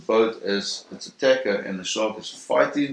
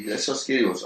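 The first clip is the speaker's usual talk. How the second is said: ordinary talk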